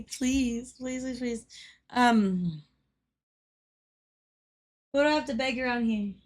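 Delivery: usual speaking voice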